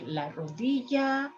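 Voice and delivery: rodilla as "rodiya"; soft y pronunciation. Shown as a demonstration. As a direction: say rodilla with a gliding y. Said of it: "Three ways".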